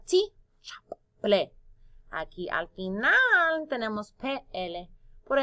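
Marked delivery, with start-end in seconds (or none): 0.0-1.5 "ti, chap, ple" with a quick chop between beats; syllables cut apart. Shown as a demonstration. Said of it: "Vamos a cortar palabra". ("ti, chap, ple" cortando las sílabas)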